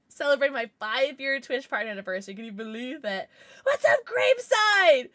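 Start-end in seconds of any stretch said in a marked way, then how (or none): 3.6-5.1 loud, high, drawn-out hail; shouted greeting. Real, not acted. Streamer hailing an arriving viewer, excited shout. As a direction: holler hello to a friend.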